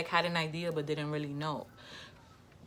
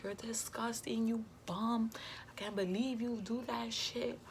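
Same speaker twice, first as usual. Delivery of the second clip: disgusted whisper; 6 semitones higher than usual. Retold or imitated. Confronting baby father on phone.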